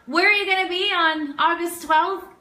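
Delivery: normal talking voice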